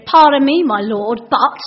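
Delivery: loud, pointed opening, falling away; Gideon, passive-aggressive. Acted. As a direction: fake politeness with an edge. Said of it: "Gideon's being quite passive-aggressive here".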